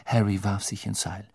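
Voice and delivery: ordinary delivery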